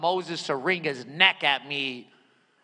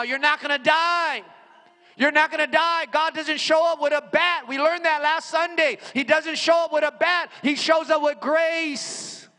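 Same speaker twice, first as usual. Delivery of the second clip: high, loud preacher voice; full-force preaching; 8 semitones higher, 4 dB louder than usual. Acted. Preacher rousing the congregation about approaching God, full-force preaching. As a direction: preach it to the room.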